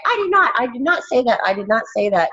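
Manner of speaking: high mock denial from mom; imitating mom denying. Daughter mimics her mom's denial, high and mocking. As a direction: play mom, squeaky and defensive. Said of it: "And she's like".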